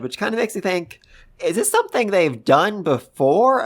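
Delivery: ordinary delivery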